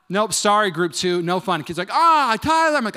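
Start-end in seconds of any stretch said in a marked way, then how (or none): 0.1-1.6 mock game-show rejection. Leader rejects the group's answer. 1.9-2.8 put-on kids' groan. Kids groan at Tyler.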